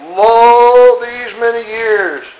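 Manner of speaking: slow, high, pompous protest; elder brother pleading his own record, slow and high; self-righteous elder brother. Acted. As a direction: drag it out like a wronged good son. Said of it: "listen to what he said".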